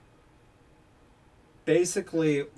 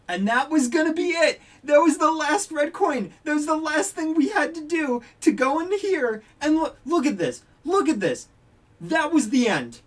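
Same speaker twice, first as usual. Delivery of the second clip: high, loud rant in real frustration; exasperated yelling; 12 semitones higher, 7 dB louder than usual. Real, not acted. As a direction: yell in exasperation at how close it was. Rages that the win was so close.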